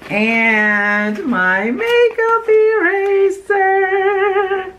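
high, very slow stretched-out announcement; excited reveal. Real, not acted. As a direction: draw it out like a delighted reveal. Excited at favorite product.

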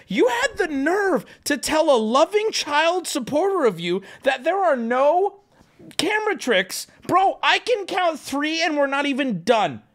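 high-pitched indignant rant; hammed-up betrayal; mad. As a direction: accuse him in theatrical, rising outrage. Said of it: "I'm so mad at Criss Angel".

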